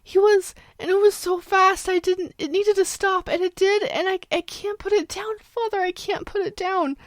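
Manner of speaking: put-on high, tearful pleading child; frightened child crying to father. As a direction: sob it out to her father. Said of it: "morphing from screaming to crying".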